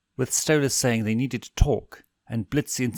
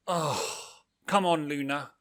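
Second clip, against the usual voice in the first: character voice; 5 semitones higher, 6 dB quieter than usual. Acted. Octavia voiced, quieter and higher.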